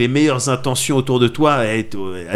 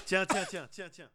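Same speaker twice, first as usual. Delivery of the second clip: fading out; 6 semitones higher, 16 dB quieter than usual. Real, not acted. Fading into silence.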